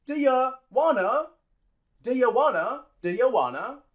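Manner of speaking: "Do ya wanna" as "duh-yuh wanna"; linked and reduced. Shown as a demonstration. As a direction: run "Do ya wanna" together, no T. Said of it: "we drop the T".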